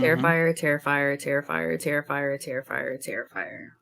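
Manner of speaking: title repeated, fading away and dropping in pitch; mock echo. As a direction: fake a dying echo with your voice.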